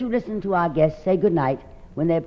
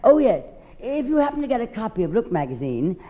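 ordinary talk

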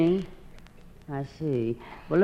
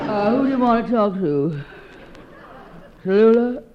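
disguised voice; 2 semitones higher than usual. Pretend. Woman dodging Jeffrey's call, lightly disguised voice. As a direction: dodge the caller in a lightly faked voice. Pretends to be someone else.

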